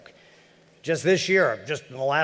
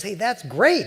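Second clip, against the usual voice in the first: cheery newcomer; 9 semitones higher, 4 dB louder, slower than usual. Retold or imitated. Newcomer replies.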